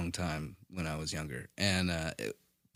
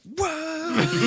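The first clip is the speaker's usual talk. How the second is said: very high mock-amazed cry; exaggerated high-pitched disbelief; astonished onlookers. Onlookers seeing three sour candies at once, mock astonishment. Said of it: "everybody'd be like".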